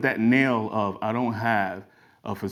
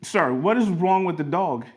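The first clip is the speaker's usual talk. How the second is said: higher-pitched, acted exasperation; exasperated demand; 8 semitones higher than usual. City driver asks attendant about the dog, exasperated. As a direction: demand an answer, baffled and fed up.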